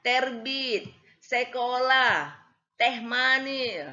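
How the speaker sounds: put-on slow, firm Batak-accented words with a hard E; lightly done Batak accent. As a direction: imitate a Batak speaker stretching each word. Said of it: "ini orang Batak nih dari ngomongnya".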